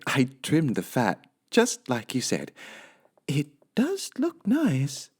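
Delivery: high, slow and pleased; high housemaid musing to herself; high, sweet Amelia Bedelia voice. Acted. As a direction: play the cheerful literal maid. Amelia speaking.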